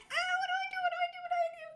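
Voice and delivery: panic, squeaking each question; panicked. Retold or imitated. Boy alone with the alarm, retelling his frantic panic. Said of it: "So I'm like".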